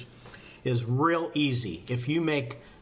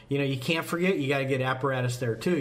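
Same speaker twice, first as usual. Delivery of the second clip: usual speaking voice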